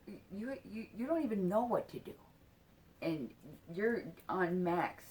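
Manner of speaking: ordinary talk, nothing marked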